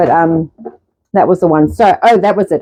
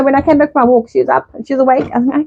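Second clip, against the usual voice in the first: ordinary talk, nothing marked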